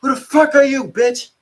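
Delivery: high, very loud mock officer's shout; fake yell. Officer challenging an inmate, imitated high-pitched yelling. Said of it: "He trying to fake yell at me and shit".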